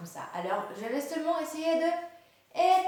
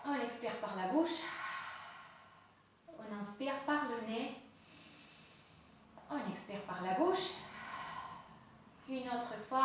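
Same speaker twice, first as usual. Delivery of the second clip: guided breathing. Acted. Guides breathing with closed eyes.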